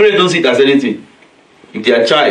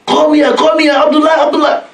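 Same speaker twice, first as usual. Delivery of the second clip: high, sustained calling voice. Acted. Parent calling a child who went away, high-pitched calling. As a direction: call after someone walking away, pitched way up. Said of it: "And you call him".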